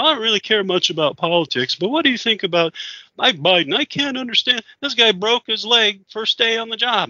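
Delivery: mock the man's confused rant; drunk man voice.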